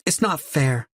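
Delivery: put-on a small boy pressing the same complaint, high; small boy voice repeated. A narrator's reading. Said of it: "repeats it".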